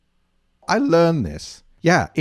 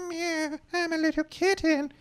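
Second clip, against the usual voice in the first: very high, soft little kitten voice; 5 dB quieter than usual. Pretend. Host plays a tiny kitten for the kids, very high and soft.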